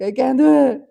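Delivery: loud, laughing mock wail of giving up; mock despair. Retelling crying on a cold school trip, laughing mock wail. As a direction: wail it through a laugh, hamming up defeat. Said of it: "and I was like".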